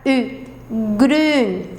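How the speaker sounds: grün with rounded lips saying I; grün: U-shaped lips, I sound; umlaut Ü. Shown as a demonstration. Teacher demonstrating Ü in grün. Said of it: "Eu faço a boca do U e falo um I".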